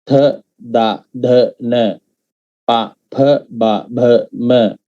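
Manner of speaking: each syllable from "ga" to "m" said separately and distinctly; distinct Pali letters. Shown as a demonstration. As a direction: recite "ga, g, ng" onward as a letter drill, puffing the H letters. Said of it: "every letter there are different to each other".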